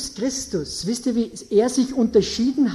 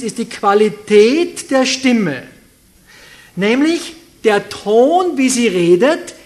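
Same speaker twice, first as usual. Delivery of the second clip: louder preaching, pressing each point; preaching at full force; 7 dB louder than usual. Acted. Preacher pressing congregation for answers, loud preaching. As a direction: drive every point home from the pulpit.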